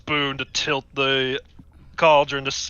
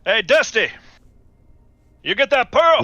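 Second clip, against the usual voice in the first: high, loud call across the room; 8 semitones higher, 4 dB louder than usual. Acted. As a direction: shout to a crewmate. Character calls out across room.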